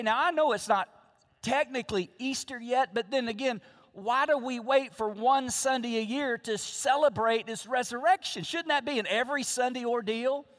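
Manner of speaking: normal talking voice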